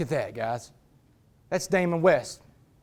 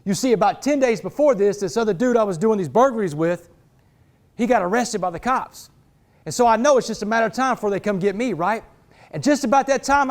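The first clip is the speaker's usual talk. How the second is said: normal talking voice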